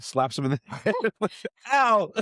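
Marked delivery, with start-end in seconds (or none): 1.7-2.1 ow yelp. Acted. Slapped in the head.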